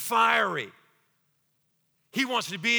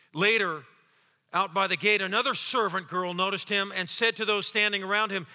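ordinary talk

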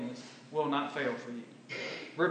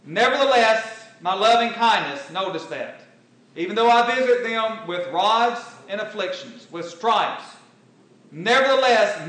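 loud, high, ringing pulpit voice; fiery preaching; 5 semitones higher, 10 dB louder than usual. Acted. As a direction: preach it out to the whole congregation. Preacher in full flow.

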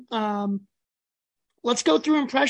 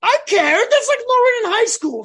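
higher and louder, fired up; heated outburst; 9 semitones higher, 6 dB louder than usual. Real, not acted. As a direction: push back hard, voice jumping up. Heated argument over D three.

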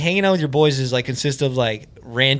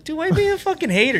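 indignant mock protest; 14 semitones higher than usual. Acted quarrel over disliked shoes.

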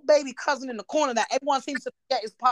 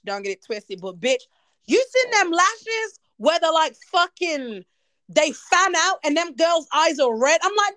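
ordinary talk

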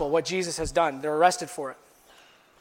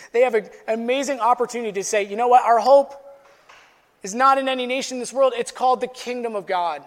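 louder, higher, fast rising proclamation; preaching climax; 4 semitones higher, 4 dB louder than usual. Acted. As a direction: proclaim it like a rallying cry.

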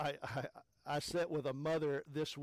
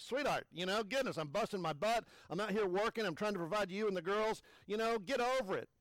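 raised, pleading complaint to the wife; exasperated husband; 6 semitones higher, 4 dB louder than usual. Retold or imitated. Husband venting at his wife over dirty dishes, exasperated. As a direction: vent like a tired, put-upon husband. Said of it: "Of course, I didn't say that. That's what I was thinking".

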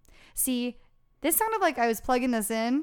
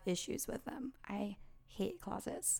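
whisper; 14 dB quieter than usual. Real, not acted. Scared side note whispered.

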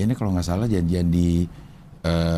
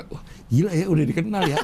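amazed; 7 semitones higher than usual. Retold or imitated. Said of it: "Iya, gue".

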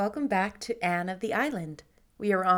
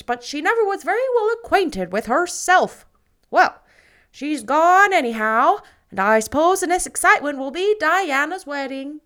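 put-on much louder, higher, opinionated old neighbor; gossiping matron; 6 semitones higher, 10 dB louder than usual. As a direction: hold forth like the village busybody. Mrs Lynde gossips.

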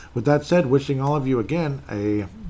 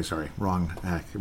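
normal talking voice

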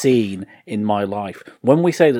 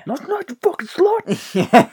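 much higher, slow and laughing; Cabin Fever guy impression; 3 dB louder, slower than usual. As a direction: squeal it out slowly through laughter. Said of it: "You know the guy in Cabin Fever?".

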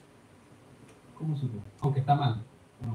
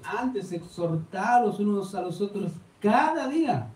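slow, measured recitation of the verse; slow solemn Bible reading; solemn scripture reading; 7 semitones higher, slower than usual. Real, not acted. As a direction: intone the verse slowly, word by word. Quoting scripture in sermon.

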